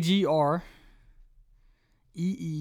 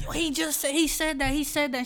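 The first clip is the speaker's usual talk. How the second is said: much higher, excited classmates' voices; high playful hype of the class; mock class; 10 semitones higher than usual. Class cheering that he got it right, in high voices. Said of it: "the whole class was like".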